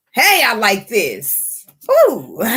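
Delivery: high, delighted squeals; can't hold back the excitement; giddy little outburst; excited. Real, not acted. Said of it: "I get excited when people start healing".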